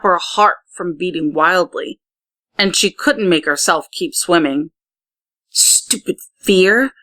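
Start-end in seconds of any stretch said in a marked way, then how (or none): 5.5-6.9 slow, irritated grumble under the breath; grumbling. A narrator's reading. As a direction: grumble at your own fear. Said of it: "Amaya grumbled".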